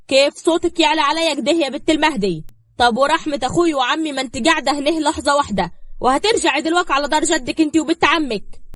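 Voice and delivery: loud, high, raging voice; furious threat. A narrator's reading. Diab threatens to throw Zahra out, enraged. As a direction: threaten her out of the house. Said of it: "وهو بيتكلم بعصبية".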